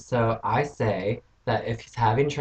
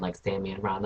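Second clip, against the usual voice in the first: ordinary delivery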